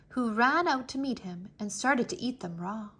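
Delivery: ordinary delivery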